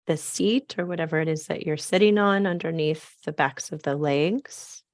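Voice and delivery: ordinary delivery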